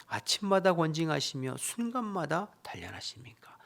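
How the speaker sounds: dramatic lament reading of Job; loud, earnest scripture reading. Acted. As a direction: read the verse as Job's anguished plea. Reads Job's lament aloud.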